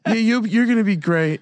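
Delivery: high, lightly mocking reassurance; hint of a nervous boss; nervous assistant GM. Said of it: "he was so nervous".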